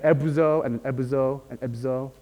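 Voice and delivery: "ebuzo and ebuzo and ebzo", the middle oo shrinking each time; oo progressively shortened. Shown as a demonstration. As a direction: step from a full oo in "ebuzo" down to none in "ebzo". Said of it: "they shortened this oo sound".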